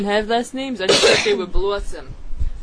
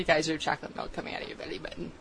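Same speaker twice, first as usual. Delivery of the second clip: fast breathy whisper; 4 semitones lower, 11 dB quieter, faster than usual. Pretend. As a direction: whisper it quickly, conspiratorially. Whispered gross question.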